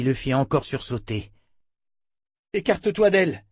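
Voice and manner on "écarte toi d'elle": higher, urgent command to step away; urgent warning. A narrator's reading. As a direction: warn him off sharply. Wizard warns Richard away.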